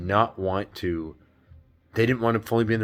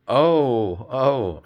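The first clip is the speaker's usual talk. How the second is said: loud, higher acted "oh"; dawning realization; 5 semitones higher, 7 dB louder than usual. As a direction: act out a sudden realization, louder.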